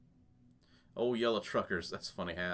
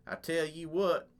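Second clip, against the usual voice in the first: slow, higher-pitched country drawl on I tell you what; country accent; 5 semitones higher, slower than usual. Acted. Survivor Troy pitching himself to the group leader, slow country drawl. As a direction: lean into a slow rural twang, pitched a bit up. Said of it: "sold me on the accent I'm gonna be using".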